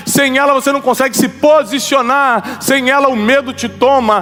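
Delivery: full-force preaching. Acted. Preacher driving the point home to the congregation, rising fervor. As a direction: hammer each sem ela home.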